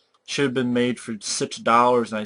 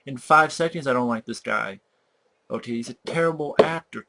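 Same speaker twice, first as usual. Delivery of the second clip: ordinary talk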